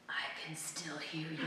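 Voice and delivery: hushed, irritated whisper. Retold or imitated. Writer whispering at her husband behind the wall, irritated. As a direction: hiss it under your breath. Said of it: "I'm like".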